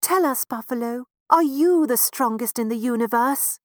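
a lightly voiced, hopeful mouse parent. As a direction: ask the buffalo hopefully. Parents ask the buffalo.

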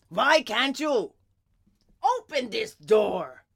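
slow, emphatic father's demand; cross storybook father, drawn out; stern warning. A narrator's reading. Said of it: "Geppetto warned".

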